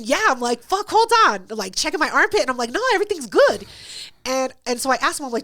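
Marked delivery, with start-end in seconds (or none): 0.6-1.5 high, loud startled outburst; suddenly worried it's her own smell; mock panic. Checking her armpit, she blurts it out in high alarm. 2.7-3.7 high, loud relieved cry; mock relief. After sniffing her armpit, relieved and loud.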